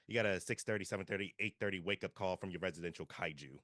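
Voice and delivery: ordinary talk, nothing marked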